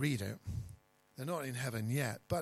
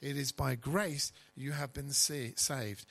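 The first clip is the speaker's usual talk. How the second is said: ordinary delivery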